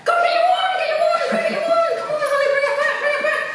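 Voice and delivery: very high, squealing dog-calling voice; excited dog cheering. Real, not acted.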